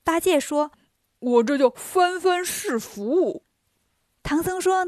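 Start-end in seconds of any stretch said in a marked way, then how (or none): 1.2-3.4 slow, drawn-out comic voice; clownish Bajie. Acted. Bajie explaining his rummaging to Tang Monk, clownishly drawn out. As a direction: stretch the pun out proudly.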